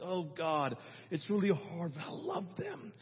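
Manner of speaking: soft, earnest prayer of a hurt mother; acted inner vow, voice kept low; hushed resolve.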